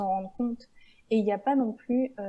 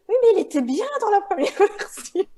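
much higher, louder, fast and laughing; exaggerated co-author; 12 semitones higher, 10 dB louder, faster than usual. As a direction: ham up her stubborn insistence. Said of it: "je me souviens qu'elle me disait".

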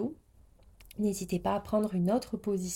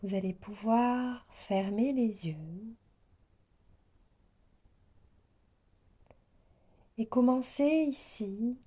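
guided meditation. Real, not acted. Yoga teacher easing listeners into yoga nidra, guided meditation voice. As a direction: settle the listener gently, phrase by phrase.

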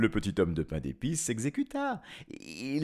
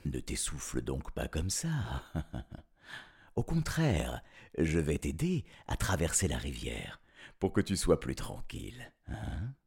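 low, soft crafty fox voice; softly scheming fox; sly fox; 7 semitones lower, 5 dB quieter than usual. Acted.

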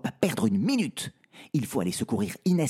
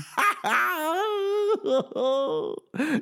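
burst of laughter. Acted. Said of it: "s'esclaffe Pedro".

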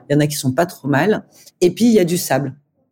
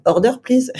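put-on high, hopeful French-accented English; broken English; 9 semitones higher than usual. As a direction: beg a stranger in clumsy English. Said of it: "je dis, euh".

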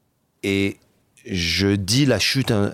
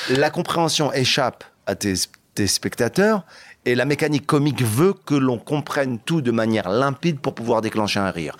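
normal talking voice

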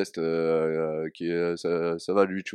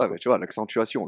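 ordinary delivery

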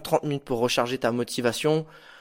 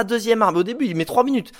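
normal talking voice

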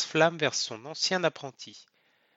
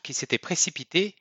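plain reading voice

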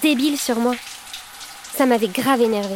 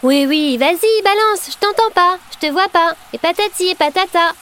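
high, sing-along brush-off; mock indifference; 4 semitones higher than usual. Girl acting out ignoring her tormentor, showily indifferent. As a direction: play at ignoring a bully, pitched up. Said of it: "«je t'ignore»".

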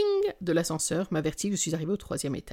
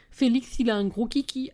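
higher-pitched lisping comic character; imitating Marie-Anne Chazel; 5 semitones higher, 3 dB louder than usual. A narrator's reading. Narrator quotes a comedy film to her friend, lisping. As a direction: put on a film character's lisp. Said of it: "en zozotant pour imiter le personnage de Marianne Chazelle".

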